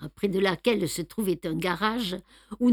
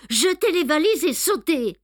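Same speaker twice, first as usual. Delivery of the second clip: urgent command; 7 semitones higher, 5 dB louder than usual. A narrator's reading. Poirot urging from the tracks.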